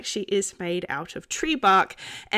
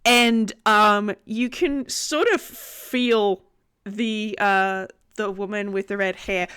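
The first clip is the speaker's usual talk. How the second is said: usual speaking voice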